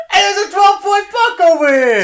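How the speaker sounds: high Louisville holler; hammed-up Louisville hunter's yell; mock Louisville accent. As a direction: shout it as a caricatured Louisvillian. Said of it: "I sound like a normal Louisvillian".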